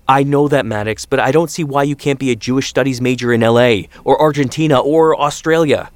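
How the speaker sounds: frustrated parent pushing alternatives; exasperated father. A narrator's reading. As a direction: argue back with rising exasperation. Father arguing exasperatedly with his son.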